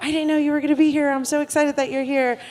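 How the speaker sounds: thrilled, quick and high; excited. Retold or imitated. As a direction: gush with surprised delight. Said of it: "folks that were like".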